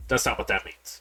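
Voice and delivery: normal talking voice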